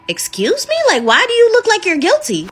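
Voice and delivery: high, sharp excuse me with attitude; indignant. Retold or imitated. Rose confronting her guilty boyfriend, indignant and high. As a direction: demand an answer, offended.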